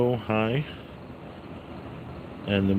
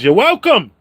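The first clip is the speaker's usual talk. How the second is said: catchphrase shout; 9 semitones higher, 8 dB louder than usual. Acted. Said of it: "the guy who says".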